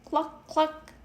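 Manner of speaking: high clucking hen; high chicken cluck. A narrator's reading. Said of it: "No hen said".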